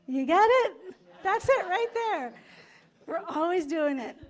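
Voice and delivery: real laughter fading; laughing. Real, not acted. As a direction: still giggling as you speak. Still laughing at the story.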